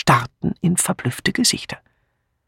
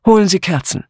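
firm, raised order; commanding; 5 semitones higher, 3 dB louder, faster than usual. A narrator's reading. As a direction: give it as a brisk command. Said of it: "befahl ihr Miss Blacklock".

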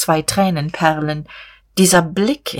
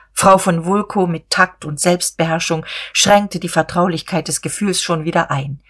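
ordinary delivery, nothing marked

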